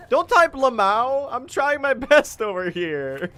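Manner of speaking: high, loud, drawn-out protest; mock-indignant shout. Real, not acted. Streamer telling teasing chat off, loud mock-indignant wail. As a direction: plead loudly through a laugh, stretching the words.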